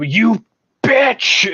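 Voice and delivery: yelling in rage at the computer; furious shout. Retold or imitated. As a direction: scream it with raw anger. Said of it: "It's just like Mother from the first one".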